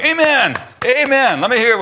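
high, loud call of amen from the pews; cheering section. Retold or imitated. Pastor imitating the cheering congregation, loud high amen. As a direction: shout it like the amen corner cheering. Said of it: "the cheering section going".

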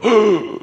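sharp, high gasp of alarm; shocked gasp. Retold or imitated. Sailors hearing of Jonah's God, a high gasp. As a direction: gasp as if struck with fear.

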